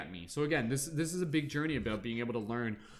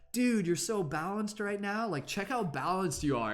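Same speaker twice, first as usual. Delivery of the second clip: higher, slow surfer-dude praise; universe praising; 7 semitones higher than usual. Acted. Universe praising your balance, higher slow dude voice. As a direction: coo it like an impressed bro. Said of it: "the universe is like".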